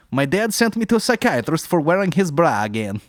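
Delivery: high, loud mock Russian accent. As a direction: play a Russian joke teller. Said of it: "a Russian accent".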